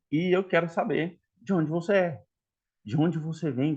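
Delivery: ordinary talk, nothing marked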